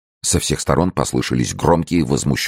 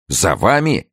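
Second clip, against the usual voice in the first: raised-pitch startled question; astonished cry; 8 semitones higher than usual. A narrator's reading. Friends exclaiming at their host's revelation, astonished cry. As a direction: exclaim it in mild alarm. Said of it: "вскричали его друзья".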